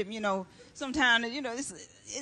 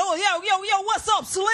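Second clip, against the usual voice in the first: louder, higher street holler across the way; hollering at her; 6 semitones higher, 5 dB louder than usual. Retold or imitated. A man hollering at a skinny woman passing by, loud and high. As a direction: call out to her like a guy on the corner. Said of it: "This brother hollered at me".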